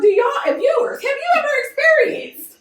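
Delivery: high, animated what-next question; excited. Real, not acted. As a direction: rise up excitedly to the viewers. Excited address to viewers.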